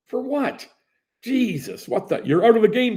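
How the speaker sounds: high, loud outraged protest; can't believe he's thrown out; outraged disbelief. Retold or imitated. Player protests being thrown out, outraged.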